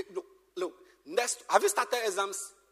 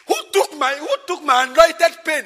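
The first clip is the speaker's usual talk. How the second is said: higher, louder mock outrage over the lost pen; mock indignant cry; 6 semitones higher, 15 dB louder than usual. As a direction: ham up the fuss over a pen. Mocking the worried owner's outcry.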